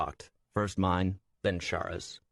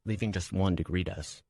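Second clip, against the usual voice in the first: ordinary delivery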